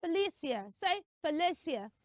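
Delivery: "Felicia" as fe-LE-sia, with s; correct pronunciation. Shown as a demonstration. Teacher asking children to repeat, correct "Felicia". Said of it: "It is Sia".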